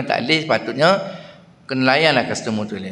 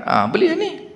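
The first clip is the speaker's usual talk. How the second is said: much higher insistent aunty; pushy aunty. Retold or imitated. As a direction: press him to buy, pitched up high. Aunty urging purchase. (mak cik mendesak beli, suara sangat tinggi)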